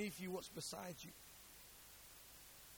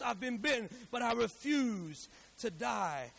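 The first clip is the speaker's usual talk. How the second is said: loud, raised pulpit cry; rousing sermon climax, urging the congregation on; full-force preaching; 6 semitones higher, 7 dB louder than usual. Acted. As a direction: hammer each repeated phrase with growing force.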